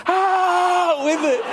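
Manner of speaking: a high, hammed-up shout; gleeful war cry. Dad imitating his daughter's gleeful ambush shout.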